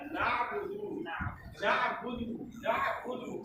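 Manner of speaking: नाबुदु with a thick throaty ain. Shown as a demonstration. Teacher drilling the ain sound in नाबुदु. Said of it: "आइन बोलना पड़ेगा, थोड़ा सा गाढ़ा".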